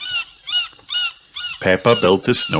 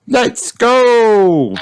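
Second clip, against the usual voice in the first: high loud excited cheer; 6 dB louder than usual. A narrator's reading. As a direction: shout it with glee. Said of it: "cheered Peppa".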